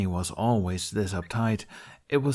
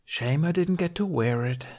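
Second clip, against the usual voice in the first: Tooth's voice, wistful; 8 semitones higher than usual. Acted. Tooth's voice, dialogue.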